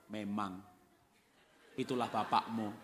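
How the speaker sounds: low, deliberate agreement with a pause; knowing disapproval. Acted. Mother running down father to child, low knowing tone.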